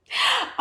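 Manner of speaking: quick, high gasp; surprised gasp. Real, not acted. Streamer reading viewer's surprising answer, high gasp. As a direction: gasp as it dawns on you.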